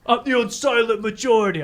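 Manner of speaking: high put-on Paulie voice; repeated Paulie bluster; Paulie impression. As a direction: crow it like Paulie. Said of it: "I love that".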